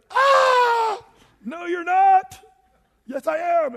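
loud, high hammed-up wail and protest; mock bad singer. Preacher acting a deluded Idol singer, loud hammed-up wail. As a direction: screech it, then insist indignantly. Mock terrible singing and argument.

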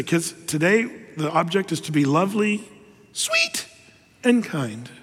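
put-on a parent's playful chant to grouchy kids; singsong lovely sweet kind. Parent coaxing grouchy kids with a sing-song chant. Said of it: "And that's how I said it".

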